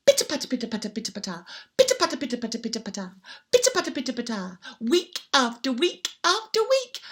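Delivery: usual speaking voice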